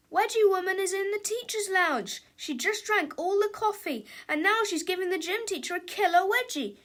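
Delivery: high-pitched, urgent voice; alarmed report. A narrator's reading. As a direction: raise the alarm in a cartoon panic. Victim raises the alarm.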